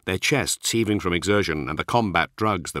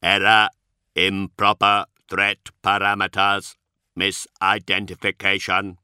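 slow, harsh and atonal, strangely inflected; harsh machine voice; 4 dB louder than usual. Acted. The Magos to the Dark Angels, a harsh atonal machine blurt. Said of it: "the Magos blurted in High Gothic".

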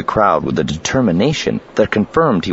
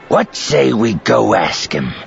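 slow, higher-pitched menacing suggestion; drawn-out thug voice; threatening captor; 6 semitones higher, slower than usual. A narrator's reading. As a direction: make the invitation a threat.

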